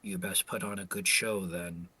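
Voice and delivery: low, quiet word to a rival; in-character challenge. Acted. Roy locking eyes with Lydell before the fight, low challenge. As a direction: say it low and slightly under the breath, in character. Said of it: "Roy will lock eyes with Lydell and just say".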